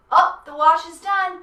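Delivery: ordinary talk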